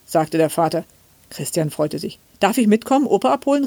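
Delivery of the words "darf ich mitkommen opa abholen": put-on high-pitched child's plea, climbing as it goes; excited boy's rising request; eager calling out. A narrator's reading. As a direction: ask eagerly to come along. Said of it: "rief er".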